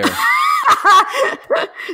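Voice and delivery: high shrieking burst of laughter; loud laughing shriek. Real, not acted. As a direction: crack up with a loud squeal. Host cracks up at joke.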